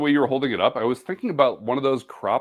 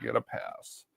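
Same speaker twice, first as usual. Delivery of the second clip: whispering; 13 dB quieter than usual. Real, not acted. Repeating a remark to himself.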